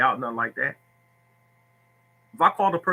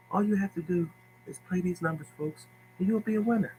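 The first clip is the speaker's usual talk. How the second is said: high, soft breathy-sweet [name] voice; 5 semitones higher, 6 dB quieter than usual. Retold or imitated. Tipster mocking a gentle pitch to followers, soft high [name]. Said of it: "talk like [name]".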